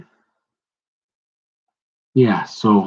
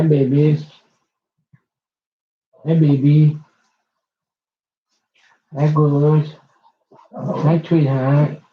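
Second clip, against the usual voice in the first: talking to a dog; 3 semitones higher than usual. Real, not acted. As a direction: coo hello to your dogs. Greeting her dogs affectionately.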